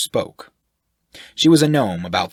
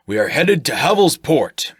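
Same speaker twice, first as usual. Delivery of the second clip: higher, louder, firm; decisive announcement; 8 semitones higher, 7 dB louder than usual. Acted. Shirk announces the destination, loud and decisive. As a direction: declare it to the whole group. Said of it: "Shirk announced decisively".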